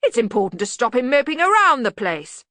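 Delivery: loud, rising bluster; blustering Arch-Chancellor. Acted. Arch-Chancellor defending his treatment of the bursar, loud. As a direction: lay it down heartily. Ridcully character dialogue.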